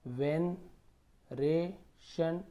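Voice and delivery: Veneration stretched very slowly, syllables apart. Shown as a demonstration. Teacher sounding out veneration slowly for students. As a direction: break veneration into slow pieces. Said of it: "तोड़ तोड़ के पढ़िए आप".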